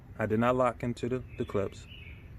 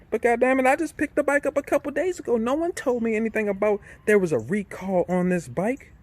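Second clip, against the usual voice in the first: exasperated outburst; 11 semitones higher than usual. Real, not acted. Viewer hears him rant at the bike shop, exasperated. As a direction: vent the anger at nobody warning you. Fuming over an unannounced recall.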